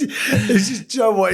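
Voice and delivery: usual speaking voice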